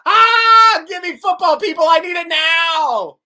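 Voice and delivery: loud and high, begging for football; impatient shout. Acted. Host reads viewer comment demanding football, loud high impatient shout. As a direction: yell it like a starving fan.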